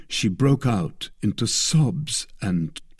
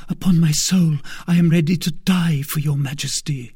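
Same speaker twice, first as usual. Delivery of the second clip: put-on vowing young maid; 7 semitones higher, 4 dB louder than usual. A narrator's reading. Maid swearing on her knees.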